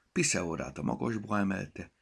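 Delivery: ordinary delivery, nothing marked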